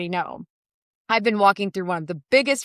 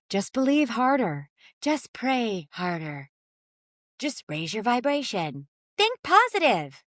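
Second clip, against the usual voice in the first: mock guru advice; 5 dB quieter than usual. Mocking certainty sellers.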